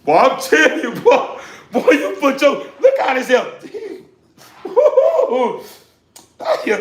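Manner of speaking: high, loud, laughing whoops; delighted whoop. Retold or imitated. Diners praising a soul food meal with loud laughing whoops. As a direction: whoop with joy over the food. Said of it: "the way they say".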